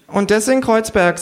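high creature voice; needling kangaroo. A narrator's reading. Kangaroo needling a rich hostess, high-pitched. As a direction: remark pointedly in a high animal voice.

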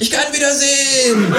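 high, jubilant kangaroo cry; ecstatic shout. Acted. Kangaroo kissing the ground outside, jubilant shout. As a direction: shout it out with relief.

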